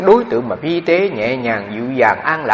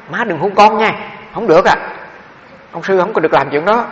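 a son insisting she must not hug him; raised monk's protest; 6 semitones higher, 4 dB louder than usual. Retold or imitated. The monk son tells his mother at the airport not to hug him, higher and firmer. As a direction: pitch climbs higher as he warns his mother off.

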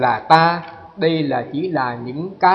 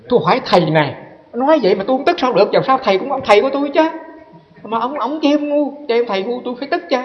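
retold indignation, much higher; indignant complaint; 8 semitones higher than usual. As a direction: protest heatedly to the master. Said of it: "sư mới phân bua". (giọng phân bua bực tức, cao giọng)

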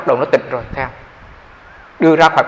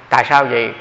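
usual speaking voice

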